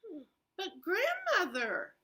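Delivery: put-on hesitant child musing aloud; puzzled girl. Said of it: "she thought".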